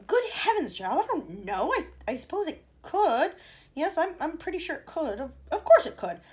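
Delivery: high, loud, stammering; flustered Wonka. A narrator's reading. Wonka flustered answering Mike's question. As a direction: stumble over the words, rattled.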